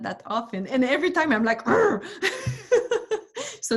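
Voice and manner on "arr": high, loud frustrated arr; frustrated growl. Retold or imitated. Frustrated at retelling the story.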